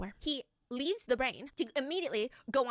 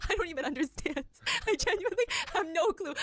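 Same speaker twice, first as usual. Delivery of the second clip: laughing, pitched up; 6 semitones higher than usual. Real, not acted. Laughing at her own confusion about math patterns. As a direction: laugh through the words in disbelief.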